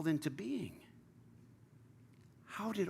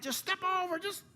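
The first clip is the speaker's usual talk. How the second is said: much higher mock call to an ant; mock yell at an ant; 8 semitones higher than usual. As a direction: playfully call down to a tiny ant. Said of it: "You're screaming at that ant".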